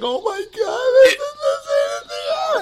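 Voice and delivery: high, cracking cry of overwhelmed joy; crying hysterically. Retold or imitated. Father re-enacting crying at his baby daughter, tearful high wail. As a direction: let the voice break into a sobbing wail. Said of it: "I'm just crying hysterically".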